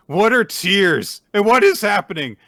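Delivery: hammed-up childlike bewilderment; naive girl voice. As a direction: play her utterly clueless about tears. Matsuri voiced, puzzled by tears.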